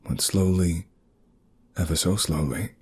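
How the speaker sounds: plain reading voice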